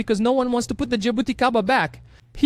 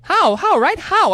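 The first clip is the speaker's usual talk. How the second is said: incredulous how; 7 semitones higher, 4 dB louder, slower than usual. Real, not acted. Disbelief at the scene.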